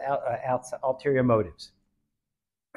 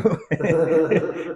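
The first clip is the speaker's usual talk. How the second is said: a burst of real laughter at the joke; laughing out loud; 5 semitones higher, 9 dB louder than usual. Real, not acted. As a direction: laugh freely at the punchline. Teller laughs at own story.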